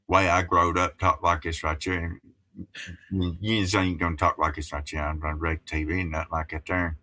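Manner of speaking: thick Appalachian hillbilly accent. Shown as a demonstration. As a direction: talk like an Appalachian back home. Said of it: "not even using my normal voice or accent".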